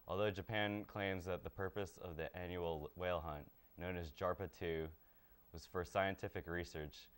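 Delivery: steady, even newscaster delivery; news read. Acted. Anchor reading the morning headlines to listeners, steady news read.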